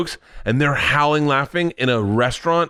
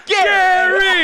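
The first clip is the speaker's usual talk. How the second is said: shouting a name; 4 dB louder than usual. Retold or imitated.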